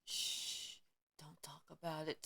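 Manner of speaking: softly hushed, almost whispered; a mock hush, breathy and low; hushed shush. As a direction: shush them like a secret. Mocking those who keep silent.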